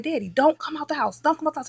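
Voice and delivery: high scared phone warning; urgent warning. Retold or imitated. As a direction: warn dad urgently to stay inside.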